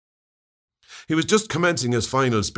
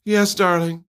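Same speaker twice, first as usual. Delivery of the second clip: high, louder cooing nurses; cooed; 10 semitones higher, 5 dB louder, slower than usual. A narrator's reading. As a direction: coo it sweetly like three doting nurses. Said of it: "Three voices cooed as one".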